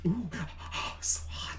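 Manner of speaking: breathy whisper, gasping at the heat. Pretend. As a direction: hush it like tasting fire. Mock mukbang, tasting spicy sauce.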